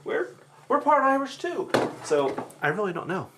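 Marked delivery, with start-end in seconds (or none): 0.0-1.7 mother's innocent voice, pitched much higher; imitating mother. Mother brushes off siblings' objection, in high mock innocence. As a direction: act the mother breezily denying it. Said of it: "She's like".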